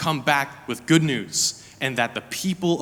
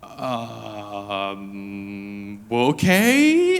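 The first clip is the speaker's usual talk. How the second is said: hesitant; 5 semitones lower, much slower than usual. Retold or imitated. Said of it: "we're like".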